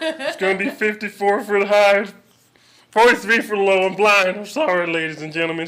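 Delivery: hammed-up weatherman in a strange voice; goofy put-on voice. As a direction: sell the forecast like a dim character. Silly weather forecast impression.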